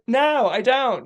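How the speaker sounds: high, loud, playful denial; coy fib. Retold or imitated. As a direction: fib sweetly and let the lie show. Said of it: "She's lying".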